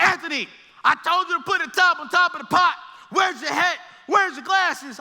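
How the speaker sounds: loud, high, fast telling-off; scolding teacher. Retold or imitated. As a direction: snap at the student for ignoring orders.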